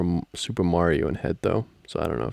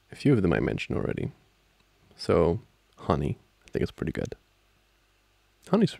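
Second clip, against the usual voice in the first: usual speaking voice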